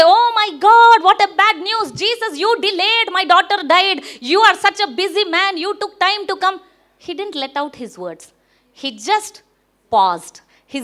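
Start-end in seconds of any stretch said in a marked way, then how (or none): 0.0-6.6 high-pitched mock lament, loud at the start; agitated complaint. A father blames Jesus for coming late, agitated complaint. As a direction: act out a distressed reproach. Acts out Jairus's imagined complaint.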